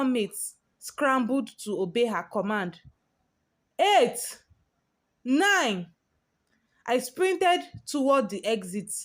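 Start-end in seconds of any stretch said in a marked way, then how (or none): 3.8-5.9 shouted countdown. Acted. Countdown continues.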